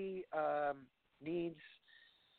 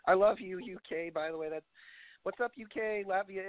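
ordinary talk

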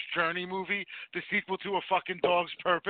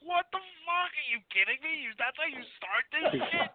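high-pitched mock outrage; a disbelieving viewer yelling at the screen, pitched up; 7 semitones higher than usual. Mock outrage. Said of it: "It was like".

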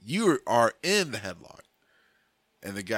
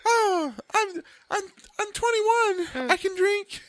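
high, slow maiden voice; giggly princess falsetto; damsel falsetto; 4 dB louder, slower than usual. Pretend. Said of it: "cause of that voice".

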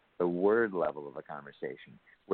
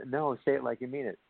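higher-pitched playful demand; mock insistence; 7 semitones higher than usual. Dissatisfied partner to husband, mock insistent. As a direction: push for a more sincere answer.

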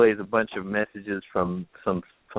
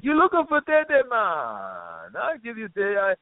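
put-on Jamaican accent; 12 semitones higher than usual. Retelling the bar's Jamaican guy offering help, Jamaican accent. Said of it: "there's this Jamaican guy there".

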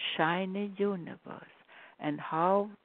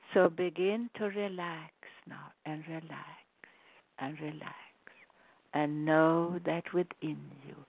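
guided meditation. Acted.

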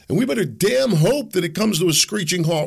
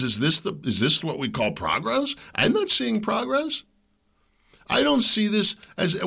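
ordinary talk, nothing marked

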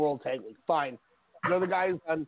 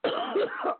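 coughing fit. Real, not acted. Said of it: "apologize for everybody hearing me coughing".

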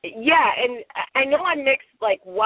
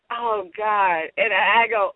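usual speaking voice

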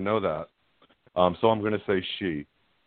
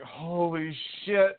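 stunned; 8 semitones higher, much slower than usual. Retold or imitated. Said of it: "And I'm like".

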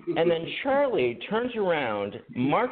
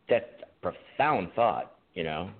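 mock profound; 7 semitones lower than usual. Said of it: "and I thought".

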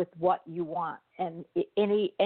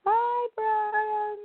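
high, drawn-out hello; high sing-song greeting, retried; 13 semitones higher, 3 dB louder than usual. Real, not acted. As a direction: call out brightly again. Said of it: "Try it differently".

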